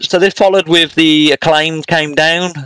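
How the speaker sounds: ordinary talk, nothing marked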